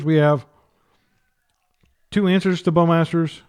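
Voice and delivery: ordinary delivery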